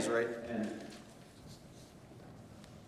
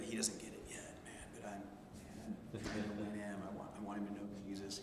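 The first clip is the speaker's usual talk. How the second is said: whispered, words barely voiced; hushed confiding; 6 semitones lower, 12 dB quieter than usual. The worker whispers to the speaker about his adult son, hushed. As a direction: lean in and whisper it like a secret.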